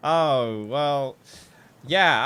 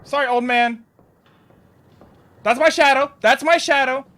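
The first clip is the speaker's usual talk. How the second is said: startled relief; 7 semitones higher, 7 dB louder than usual. Real, not acted. Startled by her own shadow.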